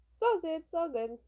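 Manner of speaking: lightly voiced upbeat idol brushing it off; carefree pop star. Pop star soothing his rattled bodyguard, high and breezy.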